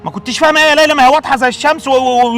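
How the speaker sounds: exasperated shout. Acted. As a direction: snap back at her excuse. الأب ينفجر. (أب منفعل بيزعق في بنته)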